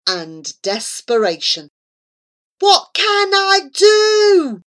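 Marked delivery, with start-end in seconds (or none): 2.6-4.6 panicked. A narrator's reading. Friend panicking at door.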